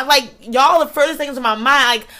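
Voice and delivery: plain reading voice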